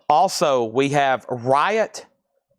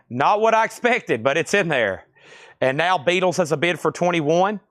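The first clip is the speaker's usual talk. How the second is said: ordinary talk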